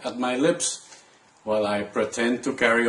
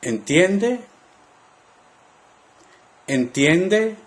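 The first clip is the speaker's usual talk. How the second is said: "Entiende" climbing high on the last syllable; rising question intonation; 5 semitones higher, slower than usual. Shown as a demonstration. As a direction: model "Entiende" as a clear question. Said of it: "listen to the rising intonation at the end of the word".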